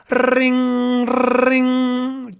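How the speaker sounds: high steady ringing voice; phone ring imitation. Office telephone ringing, high mock ring.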